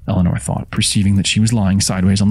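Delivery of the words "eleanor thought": plain reading voice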